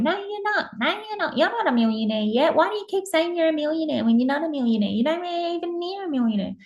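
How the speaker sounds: high, scornful inner critic voice; mocking subconscious voice. Subconscious mocking the affirmation, high and scornful. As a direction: sneer at your own dream from far up in the voice. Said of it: "your subconscious work going".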